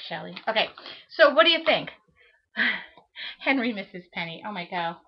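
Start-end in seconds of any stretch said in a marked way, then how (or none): none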